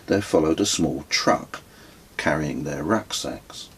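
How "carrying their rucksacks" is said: usual speaking voice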